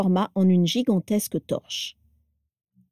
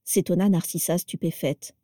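plain reading voice

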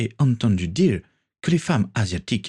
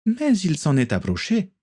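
higher, teasing female voice; lightly voiced Natalia; 11 semitones higher than usual. A narrator's reading. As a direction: tease your friend with a grin in the voice.

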